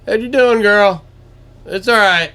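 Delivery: high, loud sweet-talk to deer; imitating dad chatting up deer. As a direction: coo loudly, like greeting a pet. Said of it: "He just talks to 'em".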